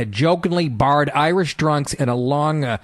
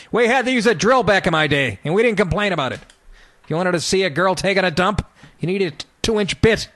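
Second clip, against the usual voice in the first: raised, cranky back-in-my-day voice; old-timer griping; 6 semitones higher than usual. Retold or imitated. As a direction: gripe like a crusty old-timer.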